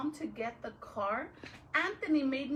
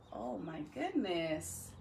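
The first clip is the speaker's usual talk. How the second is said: mock dismay; 7 semitones lower, 4 dB quieter than usual. Real, not acted.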